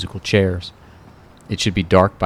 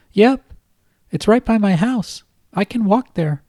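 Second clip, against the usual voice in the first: much higher girlish voice with a hint of a man underneath; a grown man faking a little girl, pitched way up; babyish high voice. Kyle faking Kate's voice on the phone to Ron, high and babyish. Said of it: "his Cuddly Kate voice holding".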